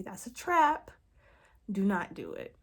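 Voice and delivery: ordinary talk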